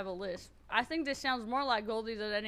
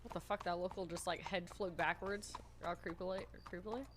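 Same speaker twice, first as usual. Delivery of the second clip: hushed; 4 semitones lower, 9 dB quieter than usual. Real, not acted. Creeped out by a local.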